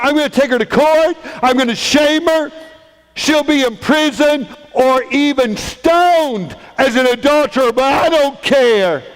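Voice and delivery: high, loud, vengeful tirade; furious vowing. Acted. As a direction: rage through the threats. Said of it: "he's so mad at Mary".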